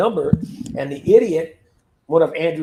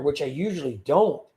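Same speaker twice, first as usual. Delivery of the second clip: ordinary talk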